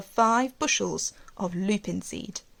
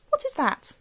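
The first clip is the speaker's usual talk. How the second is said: put-on child's voice; 12 semitones higher than usual. A narrator's reading. Child Alice asks aunt.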